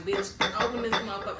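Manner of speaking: re-enacted loud door-pounding demand; mock angry demand. Retelling knocking on people's doors, mock angry demand.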